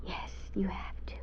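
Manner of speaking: very soft resigned whisper. Acted. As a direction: let it out almost silently. Facing the killer.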